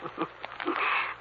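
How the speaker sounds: soft, breathy killer's chuckle under her breath; a hushed, gloating laugh; quiet sinister laugh. Acted.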